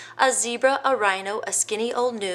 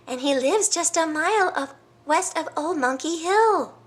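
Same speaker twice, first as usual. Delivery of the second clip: high bird voice; hornbill bird; 10 semitones higher than usual. Acted. Hornbill telling the elephant where the croc lives, high. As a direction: point the way eagerly.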